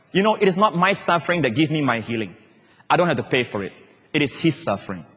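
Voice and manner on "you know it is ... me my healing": normal talking voice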